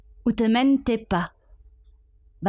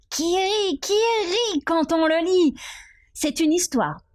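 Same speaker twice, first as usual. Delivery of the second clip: much higher, playful sing-out of the line; gleeful high recital; 8 semitones higher than usual. Acted. Reciting a poem about a laughing book, high and playful. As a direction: let the voice leap up high in play.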